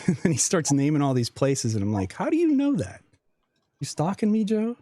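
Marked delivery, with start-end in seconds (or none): 2.1-4.8 high-pitched, incredulous retold question; teasing disbelief, pitched way up; mock suspicion. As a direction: squeak up in playful alarm at a stalker. Retelling mock suspicion of Joe.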